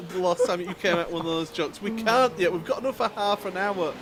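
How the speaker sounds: ordinary delivery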